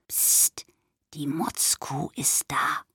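slow, breathy secret whisper; old woman whispering. A narrator's reading. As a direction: whisper slowly, as if sneaking up. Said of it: "flüsterte die Oma".

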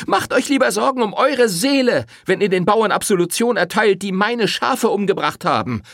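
high, loud rebuke; angry accusation. Acted. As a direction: throw it in his face. Rosetta attacks priest. (wütender Vorwurf)

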